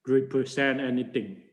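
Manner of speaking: ordinary talk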